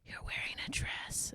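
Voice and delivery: stage whisper of a shocked student; whispered kid. Retold or imitated. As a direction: hush it like a kid gossiping. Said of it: "they're like".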